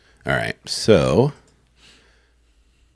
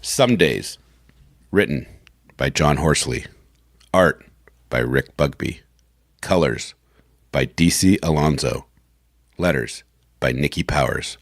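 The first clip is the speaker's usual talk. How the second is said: low, measured announcer voice; announcer credits read; 8 semitones lower, slower than usual. Acted. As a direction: read each credit low, with pauses between. Reads comic credits for recording.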